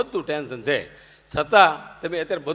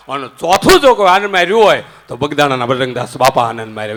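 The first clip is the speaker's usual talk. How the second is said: booming louder proclamation; preaching at full force; 2 semitones lower, 13 dB louder than usual. Real, not acted. Speaker proclaims the saint's name to the audience, booming. Climax naming the fourth saint.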